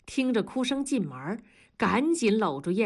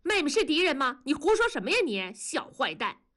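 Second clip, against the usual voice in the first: angry; 8 semitones higher, faster than usual. A narrator's reading. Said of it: "文丽一听火大了".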